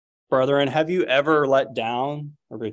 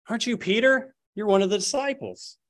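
put-on higher, probing young woman's voice; accusing servant girl; 9 semitones higher than usual. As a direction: voice the suspicious servant girl, pitched up. Servant girl questions Peter.

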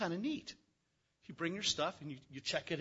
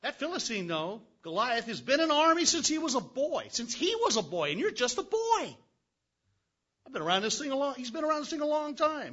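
louder, higher, mock-alarmed; incredulous warning; 9 semitones higher, 8 dB louder than usual. King warning David about the seasoned giant, mock-alarmed. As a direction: stress how outmatched he is. Saul describing Goliath.

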